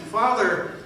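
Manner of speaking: a strong, clear call upward to the Father. Acted. Jesus on the cross calling firmly to the Father. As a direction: call out firmly, with full conviction. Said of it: "He spoke strongly and clearly".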